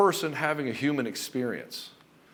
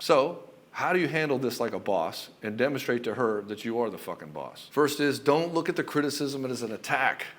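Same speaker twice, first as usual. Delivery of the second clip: usual speaking voice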